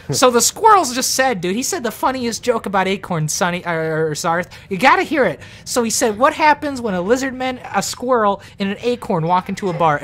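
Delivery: high-voiced gnome passing on a joke; amused gnome. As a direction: relay it excitedly as a little gnome. Gnome retells squirrel's joke.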